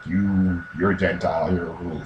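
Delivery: low, soft put-down; quiet, low imitation of scorn; mock disdain. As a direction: look down on them, voice dropped low. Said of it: "stare down at him like".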